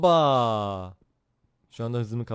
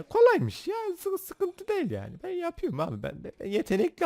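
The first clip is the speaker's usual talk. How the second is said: much higher cartoon squeak; squeaky silly voice. Bragging in a silly voice. (çok ince, çizgi film gibi böbürlenme)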